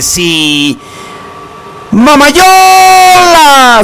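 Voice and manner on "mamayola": a long, very high, drawn-out call; stretched call. Acted. Radio DJ greets a listener with a long, very high drawn-out call. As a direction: stretch the name out like a radio shout-out, high and slow.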